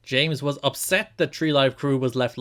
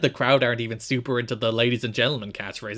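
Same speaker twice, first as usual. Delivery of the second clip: ordinary delivery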